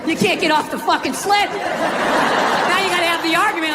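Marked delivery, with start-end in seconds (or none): none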